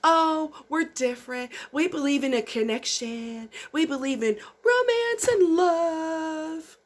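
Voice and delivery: high, sugary mock of dating site ads; sappy fake sincerity, drawn out on romance; mocking sales pitch. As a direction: parody the syrupy marketing promise. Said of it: "the, the fucking marketing".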